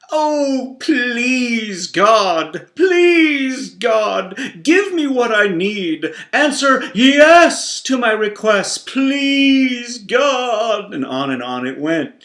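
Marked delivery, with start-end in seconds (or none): 0.1-10.9 high, loud, pleading whine; begging Pharaoh's subjects. Retold or imitated. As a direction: beg the throne like a hammed-up petitioner. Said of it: "defaulted to the television portrayal of Pharaoh".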